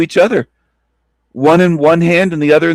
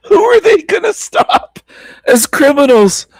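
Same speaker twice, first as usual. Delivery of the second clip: laughing high question; 9 semitones higher than usual. Real, not acted. Laughing at the video.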